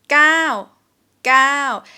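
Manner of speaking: gao said high then falling; Thai falling tone. Shown as a demonstration. Teacher demonstrating the Thai word, a loud falling tone. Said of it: "but we pronounce it".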